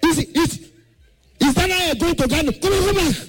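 high, shouted order to go back; airport shouter. Retold or imitated. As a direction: bark at the travellers like an angry official. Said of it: "this voice".

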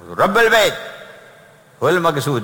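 loud, high-pitched exclamation; emphatic answer. Real, not acted. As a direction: call out the answer, high and forceful. Emphatic answer to his own question. (جواب قاطع بصوت عالٍ مرتفع)

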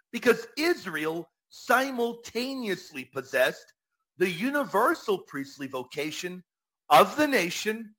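usual speaking voice

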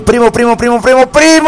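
high, rapid-fire repeats rising to a peak; eager, climbing high-pitched call; excited calling. Acted. Excited repeated greeting read out.

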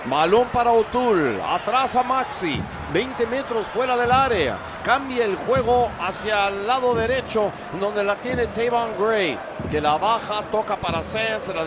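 high, projected passing call; live play-by-play. Real, not acted. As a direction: follow each pass with lifted energy.

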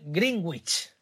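Greenwich said as "GRING-gwich", Spanish-style; Spanish pronunciation. Shown as a demonstration. Host shows how Spaniards say it, Greenwich as "gringwich". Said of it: "como lo decimos en español".

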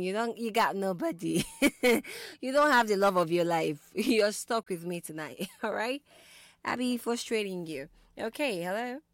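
normal talking voice

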